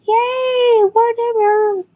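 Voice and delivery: high, drawn-out mock cheer; fake enthusiasm. Fan getting an unknown actor's autograph, fake enthusiasm. Feigned delight at unwanted autograph.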